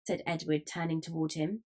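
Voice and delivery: ordinary delivery, nothing marked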